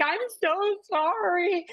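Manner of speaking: high, teary apology; tearful apology. Retold or imitated. To the crying coworker, tearful apology. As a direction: apologize through tears.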